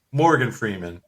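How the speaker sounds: ordinary delivery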